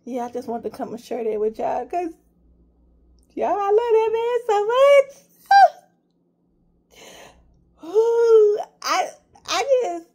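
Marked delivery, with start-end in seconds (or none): 3.3-10.1 fighting back tears while gushing; choked up. Real, not acted. Fan telling viewers about his TV idol, tearfully. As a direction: voice rising high and breaking into tears. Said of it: "ooh, it makes me emotional".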